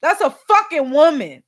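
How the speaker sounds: ordinary talk, nothing marked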